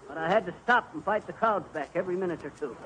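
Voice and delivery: ordinary talk, nothing marked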